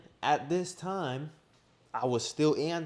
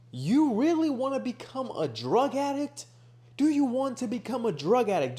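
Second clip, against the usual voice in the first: loud, high-pitched outraged scolding; furious; 10 semitones higher, 6 dB louder than usual. Retold or imitated. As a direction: re-enact yelling sense into a friend. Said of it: "I was furious".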